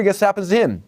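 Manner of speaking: usual speaking voice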